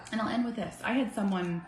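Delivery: normal talking voice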